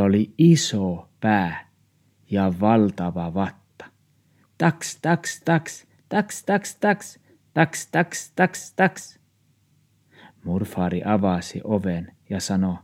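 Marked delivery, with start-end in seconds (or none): 4.6-9.2 high yappy barks; little dog barking. Acted. Small dog barks at two dogs outside, high yaps. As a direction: yap like a tiny dog.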